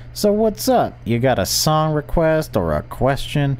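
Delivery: high, upbeat radio host; chirpy late-night DJ. Acted.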